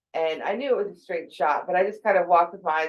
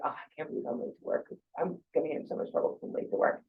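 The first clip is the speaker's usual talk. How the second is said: fast, whispered worrying to yourself; anxious whisper; 3 semitones lower, 10 dB quieter, much faster than usual. Retold or imitated. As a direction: rush the panicked thoughts in a whisper. Voicing anxious inner thoughts.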